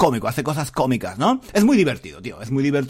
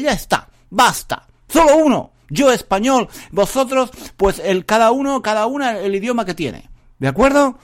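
high, loud hammed-up tirade; playful mock fury, high and loud; mock outraged rant; 7 semitones higher, 4 dB louder than usual. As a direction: rant in jest as if furious. Mock rant against languages.

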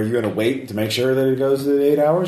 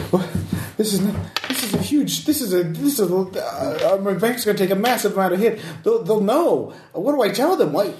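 high, distraught and frantic; a victim horrified at his losses; panicking businessman; 9 semitones higher than usual. Acted. NPC panics about bank.

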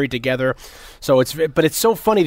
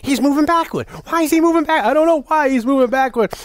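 higher, puzzled repeated question; mock baffled old man; 13 semitones higher than usual. Old operator puzzled at the board, higher and baffled. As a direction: sound lost and confused. Old guy voiced in retelling.

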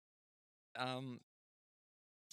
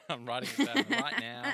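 high, loud, laughing reply; 7 semitones higher, 7 dB louder than usual. Real, not acted. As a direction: say it through a burst of laughter. Laughing reply to being told off.

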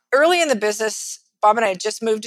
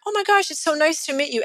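higher-pitched, gushy hello; gushing greeting; 6 semitones higher than usual. Retold or imitated. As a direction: greet her as if thrilled, retold. Retold gushing greeting to client's wife.

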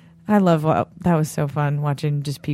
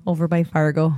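put-on North Dakota accent. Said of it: "do North Dakota?".

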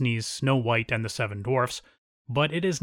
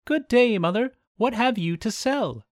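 put-on young princess voice; 9 semitones higher, 3 dB louder than usual. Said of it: "Snowdrop peeped out of the window and said".

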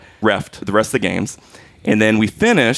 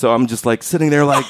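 ordinary talk, nothing marked

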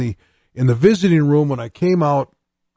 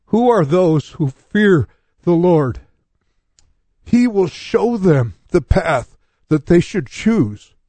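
slow, measured verse reading, slightly raised; solemn scripture reading; 3 semitones higher, slower than usual. Acted. Preacher rereads his opening verse to the congregation, slow scripture reading. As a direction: read the verse out slowly and deliberately.

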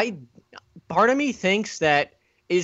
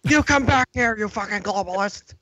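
hammed-up angry chase yell, pitched up; mock Alex Jones yell; 5 semitones higher than usual. Friends mocking Alex Jones chasing a coffee thrower, hammed-up yell. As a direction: bark it like a ranting conspiracy host.

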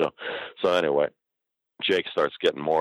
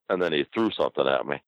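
normal talking voice

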